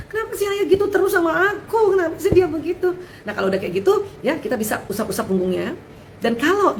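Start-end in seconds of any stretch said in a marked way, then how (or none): 0.1-3.0 put-on child's plaint, high and half laughing; mock a child near tears, lightly; upset child. Anak menangis mengeluh tentang ayah. (anak merengek, nada tinggi setengah tertawa)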